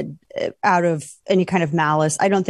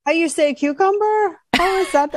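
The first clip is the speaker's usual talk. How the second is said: mock Spanish accent; 10 semitones higher than usual. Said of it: "her fake Spanish accent".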